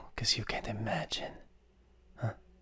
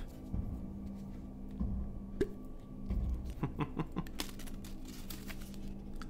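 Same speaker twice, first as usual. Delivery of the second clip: evil whispered cackle; 5 semitones higher, 10 dB quieter than usual. Acted. Demon laughing at victim.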